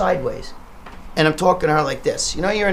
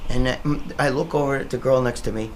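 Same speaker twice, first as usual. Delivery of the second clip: ordinary delivery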